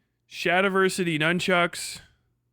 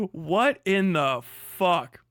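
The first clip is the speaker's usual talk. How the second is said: slow disbelief; much slower than usual. Real, not acted. Disbelief at thumbnail.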